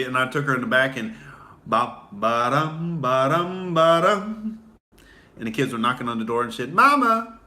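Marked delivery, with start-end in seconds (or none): 1.7-4.6 rhythmic mock thumping. Sex sound effect. 6.7-7.4 put-on high, loud kid calling mom; child's shout. Kids knocking on bedroom door, high loud child call.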